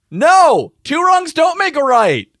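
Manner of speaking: put-on loud, high-pitched kid's outburst; Surprise Kid. Game master as a kid blurting out, high shout. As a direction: yell it high like an outraged kid. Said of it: "as Surprise Kid all of a sudden goes".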